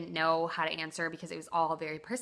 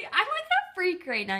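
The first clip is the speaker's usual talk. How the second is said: high, nervous outburst; real jitters, voice jumping up; scared; 11 semitones higher, 3 dB louder than usual. Real, not acted. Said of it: "I don't know why I'm so scared".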